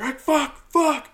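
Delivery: high, exasperated mock outburst; freaking out. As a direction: imitate someone cursing in a meltdown. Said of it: "he, like, freaks out".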